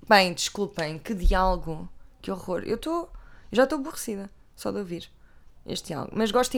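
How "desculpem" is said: ordinary delivery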